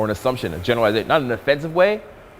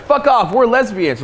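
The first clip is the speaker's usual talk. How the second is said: put-on dismissive girl; 9 semitones higher, 4 dB louder, slower than usual. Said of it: "A girl's like".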